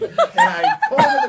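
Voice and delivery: laughing through words. Real, not acted. Laughing while telling.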